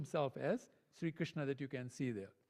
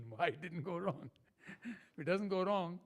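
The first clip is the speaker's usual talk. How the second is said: laughing; 4 dB quieter than usual. Real, not acted. Laughing at his own joke.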